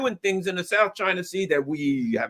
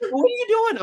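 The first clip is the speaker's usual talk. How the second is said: much higher, incredulous; incredulous question. Pretend. Host asking the would-be shooter what he's doing, much higher. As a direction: ask as if he's lost his mind.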